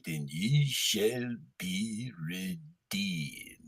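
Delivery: deep, slow, grand biblical voice; Charlton Heston impression. Mock Moses to his friends, deep and slow. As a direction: intone it like an epic prophet. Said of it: "Haven't really done a Charlton Heston impression".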